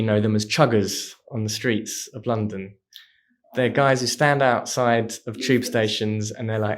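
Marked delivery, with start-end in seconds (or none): none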